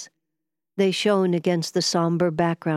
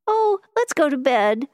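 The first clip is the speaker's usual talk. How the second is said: high-pitched, louder plea; pleading; 10 semitones higher, 5 dB louder than usual. A narrator's reading. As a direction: plead in a high, acted woman's voice. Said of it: "murmured pleadingly".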